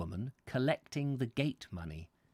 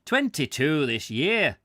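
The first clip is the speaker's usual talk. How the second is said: louder, broad Scots caretaker; thick Scottish brogue, pushed louder; broad Scottish accent; 3 semitones higher, 6 dB louder than usual. A narrator's reading. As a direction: answer in a hammed-up Scottish accent. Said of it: "said the caretaker in a very broad accent".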